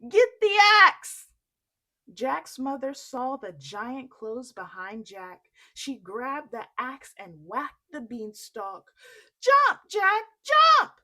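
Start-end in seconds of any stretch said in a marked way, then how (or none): 0.1-1.3 very loud, high urgent shout; urgent yell. Acted. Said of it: "he yelled". 9.4-11.0 very loud, high hollered call; mother hollers at Jack while chopping; hollering. Acted. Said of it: "she hollered".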